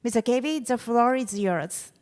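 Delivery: normal talking voice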